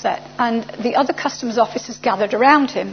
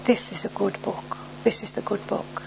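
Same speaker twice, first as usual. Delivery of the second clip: gentle lowered voice, much softer; very quiet; 2 semitones lower, 8 dB quieter than usual. Retold or imitated. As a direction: say it hushed, almost to yourself. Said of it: "he said very quietly".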